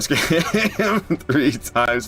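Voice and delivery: laughing through the words; can't hold back a laugh; chuckling as he reads it out. Real, not acted. Laughing while reading chat.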